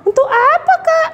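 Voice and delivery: put-on high curious child question; puppet girl Mimi. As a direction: ask like a curious little girl. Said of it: "adik-adik".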